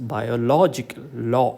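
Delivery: slow biological, then law alone; stress on LO. Shown as a demonstration. Teacher marking the important syllable, LO stressed in biological. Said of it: "syllable which is given importance".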